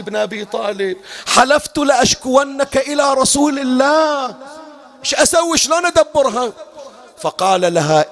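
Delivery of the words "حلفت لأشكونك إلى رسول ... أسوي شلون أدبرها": anguished plea. Retold or imitated. Sister torn over her oath.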